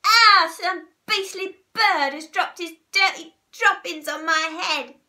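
high, loud disgusted outburst; outraged storybook squawk; disgusted cry. A narrator's reading. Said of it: "cried Missus Twit".

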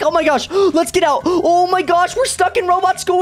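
ordinary talk, nothing marked

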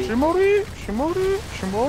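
shrill singsong dying wail; high mock wail. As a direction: wail it like a cartoon death.

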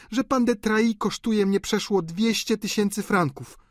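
high, indignant woman's voice; acted family quarrel, pitched high; quarrelling daughter. A narrator's reading. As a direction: hurl high-pitched reproaches at a sister. Countess and sister quarrelling in the story.

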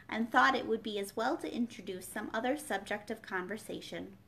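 ordinary delivery, nothing marked

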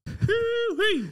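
high, loud cowboy "hoo-wee"; mock American whoop. Joining the mock American accent.

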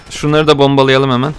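ordinary talk

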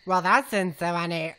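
usual speaking voice

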